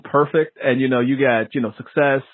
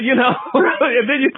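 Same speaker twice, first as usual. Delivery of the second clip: ordinary talk, nothing marked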